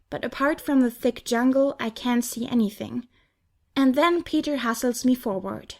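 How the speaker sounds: usual speaking voice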